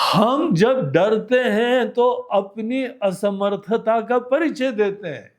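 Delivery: ordinary talk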